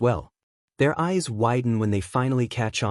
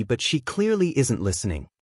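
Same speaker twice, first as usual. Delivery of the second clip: plain reading voice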